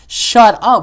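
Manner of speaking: loud, high retort. Retold or imitated. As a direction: throw it out sharply, short of screaming. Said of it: "Not really yelling, we're just kinda like".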